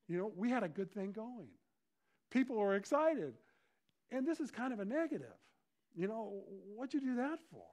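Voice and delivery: tactfully object, pitch climbing as you go; disciple's awkward protest. Acted. Disciple taking his Lord aside, awkwardly protesting. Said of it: "take him aside and say".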